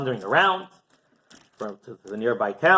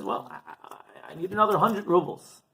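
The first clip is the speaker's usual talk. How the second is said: hesitant poor man; 5 semitones higher, 3 dB quieter than usual. Acted. Poor man hesitantly names sum.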